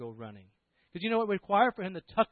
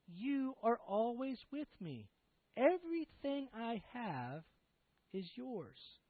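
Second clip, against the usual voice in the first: slow, soft and higher; tenderly plead, word by word; gentle, unhurried reassurance; reassuring father; 6 semitones higher, 6 dB quieter, slower than usual. Acted. Father reassures his angry son.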